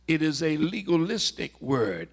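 normal talking voice

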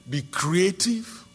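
normal talking voice

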